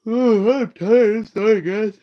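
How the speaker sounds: hammed-up superhero sigh of fatigue; Homelander impression. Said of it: "How's Homelander say this?".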